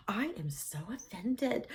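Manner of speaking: slow mock-offended whisper. Teller reacting to the doctor's disgust, offended whisper. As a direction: whisper it slowly, playing deeply offended.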